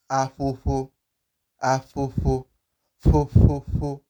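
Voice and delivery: afofo with each f blown between both lips; afofo, breathy puffs of air for each f; Urhobo-style ph. Shown as a demonstration. Teacher modelling the digraph, puffed ph. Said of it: "as I'm pronouncing the P H diagram".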